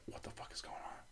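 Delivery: soft breathy what-the-fuck; whispered confusion. Retold or imitated. Patient confused by his strokes, whispered bewilderment.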